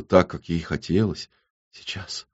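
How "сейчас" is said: very soft, voiceless whisper. A narrator's reading. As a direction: whisper it, barely audible. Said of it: "прошептала Ксана". (едва слышным шёпотом)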